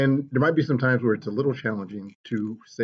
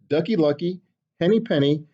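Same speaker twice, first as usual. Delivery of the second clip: ordinary delivery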